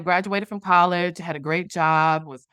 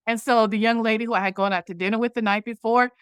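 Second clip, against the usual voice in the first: normal talking voice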